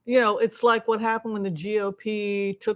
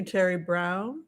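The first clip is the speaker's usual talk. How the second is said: usual speaking voice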